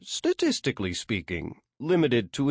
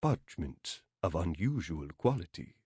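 put-on softer Arabic-accented answer; Nkosi El Kasab's Arabic accent; 5 dB quieter than usual. A narrator's reading. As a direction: answer quietly with an Arabic lilt. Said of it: "the lilt of an Arabic accent".

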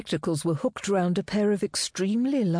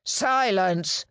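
higher, harsh command to the cells; sergeant snarling; 4 semitones higher than usual. A narrator's reading. Sergeant silencing the prisoners, a snarled order. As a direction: bark it across the room. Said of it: "snarled the sergeant".

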